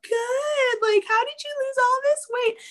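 put-on high, gushing girly voice of amazed classmates. Schoolmates gushing over her weight loss, high squealy voice. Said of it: "people would be like".

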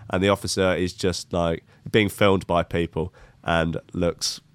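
ordinary talk

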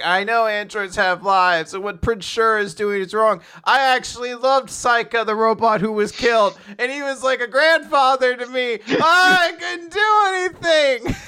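hammed-up tearful confession, climbing to a cry; mock tearful. Podcaster imitating Milani crying over the killed robot, mock tearful. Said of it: "Milani starts crying 'cause she's like".

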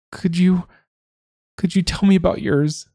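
put-on high, hesitant girl's voice with a stumble; timid young woman. A narrator's reading. Grieving Bridget asking Siobhan about her grandmother, high and hesitant. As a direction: ask shyly, half afraid of the answer. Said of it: "she asked".

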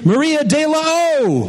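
big emcee build on the name, pitched high; emcee introduction. Real, not acted. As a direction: call the next performer up to the stage. Host introduces the next storyteller.

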